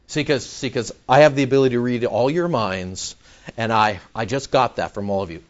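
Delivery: ordinary talk, nothing marked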